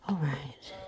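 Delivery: soft whisper. Real, not acted. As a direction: breathe it quietly. Whispering near sleeping baby.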